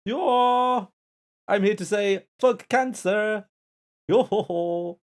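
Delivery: loud, high Finnish accent attempt. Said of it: "in a Finnish accent".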